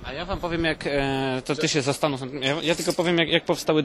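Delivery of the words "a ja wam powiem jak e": normal talking voice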